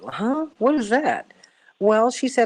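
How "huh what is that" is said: high, baffled question; puzzled disbelief, pitched up; incredulous. Retold or imitated. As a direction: react as if it makes no sense. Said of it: "I'm like".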